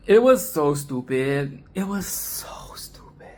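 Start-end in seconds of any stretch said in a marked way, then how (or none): 1.7-3.3 whispered, much softer. Shown as a demonstration. Reviewer whispering her verdict on the film. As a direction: breathe it as a secret aside. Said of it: "repeated as whisper".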